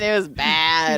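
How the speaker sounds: normal talking voice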